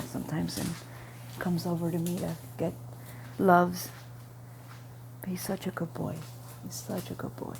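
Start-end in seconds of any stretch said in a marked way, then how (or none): 5.2-7.6 whispered praise of the dog; a near whisper of fond praise; soft dog praise. Real, not acted. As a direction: murmur it fondly.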